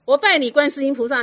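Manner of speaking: loud, devoted cry of worship; fervent kneeling plea. Retold or imitated. Grateful patient kneeling before his teacher, loud devoted cry. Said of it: "他跪着说".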